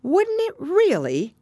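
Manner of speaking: high, drawn-out astonishment; great surprise. A narrator's reading. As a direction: marvel at it, high and slow. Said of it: "in a tone of great surprise".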